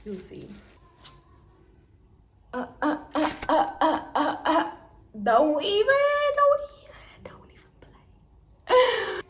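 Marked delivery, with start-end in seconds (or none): 2.5-4.7 excited stammer. Real, not acted. 5.2-9.2 excited whisper. Real, not acted. Excited whisper over glasses.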